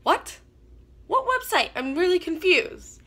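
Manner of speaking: high, startled what website; sudden confused shock; pitching up in disbelief; confused surprise. Retold or imitated. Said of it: "and I'm like".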